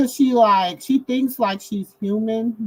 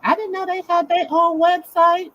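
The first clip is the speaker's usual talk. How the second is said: ordinary delivery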